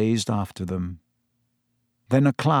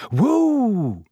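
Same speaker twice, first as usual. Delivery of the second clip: high sudden cry to halt the beast; Harry reining in Buckbeak, high startled call; startled whoa; 8 semitones higher than usual. Acted. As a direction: yank back the reins with a yelp. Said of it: "he said, pulling backward".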